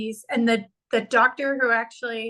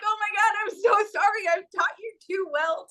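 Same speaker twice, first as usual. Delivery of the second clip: high-pitched, gushing oh-no apology; acted-out dismayed apology; 9 semitones higher than usual. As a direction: re-enact an aunt's comic horror at herself. Acting out apology to crying niece.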